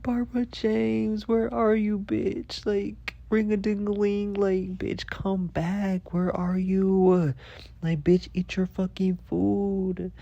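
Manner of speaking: high, drawn-out whiny wail; mock tearful calling. Yelling for the cat in the snow, mock crying. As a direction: keep wailing for the cat, comically overdone. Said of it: "yelling her name".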